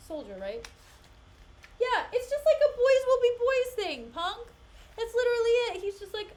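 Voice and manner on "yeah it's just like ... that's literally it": loud, high, exasperated rant; a real, furious venting; indignant rant. Real, not acted. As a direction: blast out the outrage. Ranting about boys will be boys.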